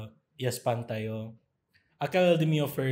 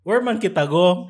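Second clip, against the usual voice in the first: laughing mock question; 6 semitones higher, 11 dB louder than usual. Mocking mixed-up Manila talk.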